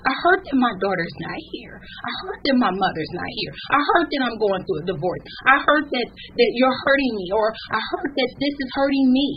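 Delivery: high, loud preaching cry; preaching at full force. Acted. Exhorting grieving listeners to tell God their hurt, full-force preaching. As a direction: lift the congregation up, full voice. Crystal preaching on grief, rising.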